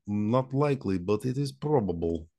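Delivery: put-on measured Russian-accented answer. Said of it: "you might be a Russian asset".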